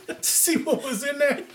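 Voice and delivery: high-pitched punchline through laughter; mock outrage, laughing; laughing disbelief. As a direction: barely hold the laugh in while quoting. Retelling comic's outraged punchline, laughing.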